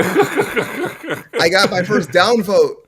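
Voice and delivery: loud, high, laughing boast; high-pitched, drawn-out laughing outburst; laughing exclamation. Real, not acted. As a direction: crow it slowly through real laughter. Laughing over a first downvote.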